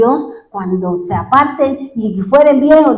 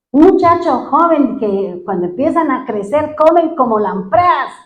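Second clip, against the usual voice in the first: ordinary talk, nothing marked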